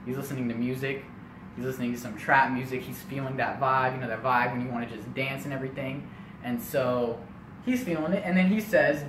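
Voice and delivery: ordinary talk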